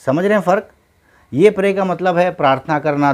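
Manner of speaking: usual speaking voice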